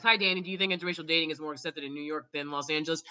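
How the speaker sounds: low, soft, fast put-on reader voice; reading a comment. Reading a viewer's question to Danny, low and soft, quickly.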